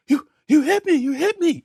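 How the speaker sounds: gasping, very high disbelief; imitated shock. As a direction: mimic a stunned man, voice shot upward. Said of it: "in shock".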